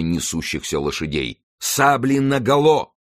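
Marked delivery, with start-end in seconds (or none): 1.6-2.9 high, slow, drawn-out shout; battle command. A narrator's reading. Winter commands over thundering hooves, high drawn-out shout. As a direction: call it over the galloping horses. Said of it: "раздалась команда Винтера, покрывая собой топот".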